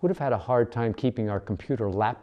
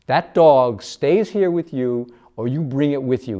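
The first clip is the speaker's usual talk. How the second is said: loud ultimatum; 3 semitones higher, 8 dB louder than usual. A narrator's reading. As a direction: lay down the law. Manny threatens Patty.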